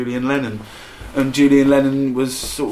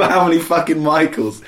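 ordinary talk, nothing marked

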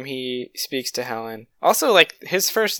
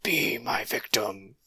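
slow breathy ghostly whisper; 3 semitones lower, 8 dB quieter, slower than usual. Retold or imitated. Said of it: "he's like a ghost voice".

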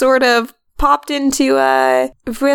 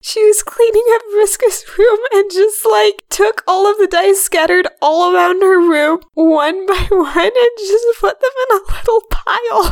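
high and amused, laughing; high excited voice; 9 semitones higher than usual. Real, not acted. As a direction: squeal it with delight.

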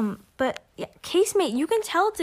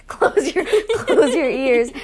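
louder, laughing repeat of the words; 3 semitones higher, 8 dB louder, slower than usual. Real, not acted. Cohost teasing over "close your ears", laughing. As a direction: say it through giggles.

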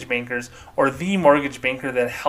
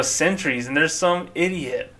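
ordinary talk

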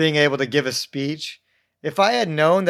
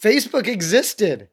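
high, louder, exasperated comic protest; mock indignant disbelief; 7 semitones higher, 4 dB louder than usual. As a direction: pile on the incredulous complaints. Retold mock outrage at friends.

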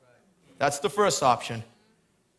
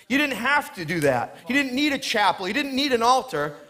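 preaching; 6 semitones higher than usual. Acted. Preacher telling the congregation God makes ground holy, fervent. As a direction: pitch lifted, preaching with rising fervour.

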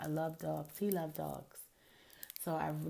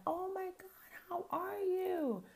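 much higher, slow delighted gush; imitating mother's gush; slower than usual. Mother greeting the ex, high baby-talk gush. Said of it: "my mother was like".

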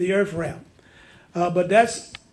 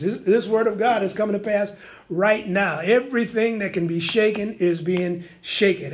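ordinary talk

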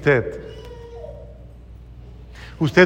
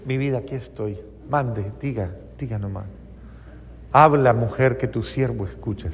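a soft, lower, doting reply to a wife; mock tender husband; 6 semitones lower, 8 dB quieter than usual. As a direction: murmur it softly like a devoted husband. Husband answering wife obediently.

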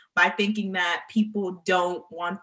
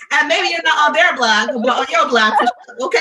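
loud, laughing, high hype call; laughing hype shout; 7 semitones higher, 10 dB louder than usual. Real, not acted. Host hyping up TikTok viewers, loud laughing shout.

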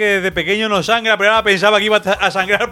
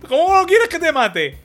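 much higher, slow, laughing; squeaky father; 12 semitones higher than usual. Retold or imitated. The father questions his son, much higher and slow. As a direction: squeal it in disbelief. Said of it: "continues father voice".

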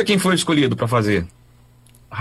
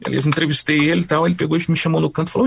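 ordinary delivery

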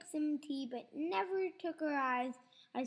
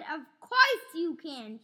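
a sharp, louder snap; snapping; 9 dB louder than usual. A narrator's reading. Old grandma snaps at George, sharp and loud. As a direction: grandmother snapping back at the boy. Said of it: "she snapped".

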